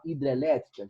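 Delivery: normal talking voice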